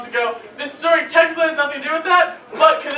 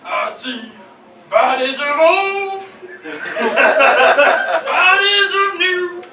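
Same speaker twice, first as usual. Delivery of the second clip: dramatic prophecy; 6 semitones higher, 3 dB louder, much slower than usual. Pretend. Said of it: "I started, and I said".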